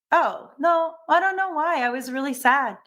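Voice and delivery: lightly put-on high voice, waving away concern; imitated dismissive reply. Retold child dismissing earlier crying.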